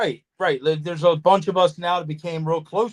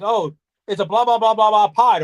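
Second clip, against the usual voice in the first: higher, louder blabbering imitation of excited people; excited mimicry; 5 semitones higher, 7 dB louder than usual. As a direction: rattle off loud mock blahs, imitating them. Retold excited people yelling over.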